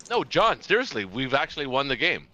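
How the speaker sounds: loud, high, emphatic protest; excited insisting. Real, not acted. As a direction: insist loudly you've won. Insisting on victory to friend.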